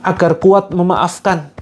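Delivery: pleading prayer intoned phrase by phrase; earnest guided prayer. Acted. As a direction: lead the group in begging God for strength to forgive. Leads a forgiveness prayer.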